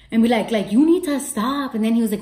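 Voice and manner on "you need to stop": stern doctor. Retold or imitated. Doctor scolding her.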